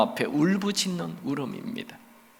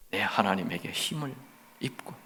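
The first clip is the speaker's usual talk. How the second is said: much softer, almost breathed; hushed after the cry; 7 semitones lower, 10 dB quieter than usual. Acted. Preacher voicing David, dropping to a soft low whisper. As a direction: let the cry collapse into a soft low close.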